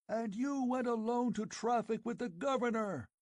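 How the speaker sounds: high, frenzied accusing yell. Acted. Old chief yelling at Conan, frenzied. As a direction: work yourself into a froth. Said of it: "yelled the Afghuli".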